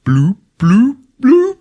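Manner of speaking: high, loud bubble noises; imitated underwater bloops. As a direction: pop out cartoonish rising bloops. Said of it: "underwater and he's like".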